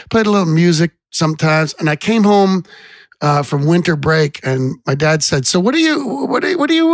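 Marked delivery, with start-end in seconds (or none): 5.5-6.9 put-on Boston accent. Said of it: "his Boston accent".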